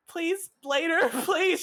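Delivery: high, slow pleading with a laugh; laughing plea. Acted. A woman pleading to hang up, high and slow with a laugh. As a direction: beg drawn out, laughing. Final plea before hanging up.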